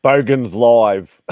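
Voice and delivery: proud title call; loud, slow announcement. Shown as a demonstration. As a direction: announce it loudly and slowly like a show title. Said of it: "pitching a show name".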